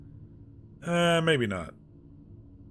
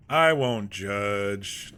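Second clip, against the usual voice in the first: very slow, louder, stretched out; drawn-out; 5 dB louder, much slower than usual. Shown as a demonstration. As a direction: stretch every word out mockingly. Repeats the line drawn out.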